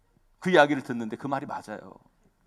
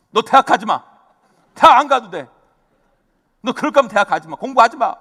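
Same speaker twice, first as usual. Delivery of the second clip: louder, sharp angry outburst at the daughter; retold angry scolding; 5 semitones higher, 10 dB louder than usual. Father retelling his angry outburst at his daughter, loud scolding. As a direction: bark the commands like a furious dad. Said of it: "엄청 막 야단을 쳤어요".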